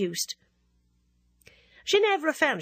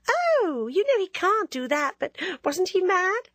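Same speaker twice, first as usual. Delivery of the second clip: a coquettish schoolgirl needling her friend; teasing Ginevra; 8 semitones higher, 4 dB louder than usual. Acted. Coquette teasing narrator, higher lively voice.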